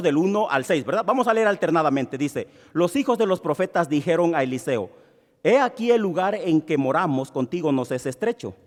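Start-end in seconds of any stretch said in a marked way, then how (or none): 2.7-8.5 measured Bible reading to the congregation; scripture reading. Acted. Pastor reading the passage to the church, steady reading. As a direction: read the verses out steadily for all.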